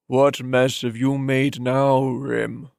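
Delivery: put-on slow, thick old man's voice from the throat; an old master's phlegmy scolding growl. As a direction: scold through phlegm, slowly. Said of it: "in a phlegmy voice that came right from his throat".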